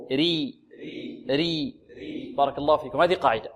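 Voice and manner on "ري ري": ري with a thin, light r; light raa. Shown as a demonstration. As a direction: drill ري held long for repetition. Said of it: "الترقيق".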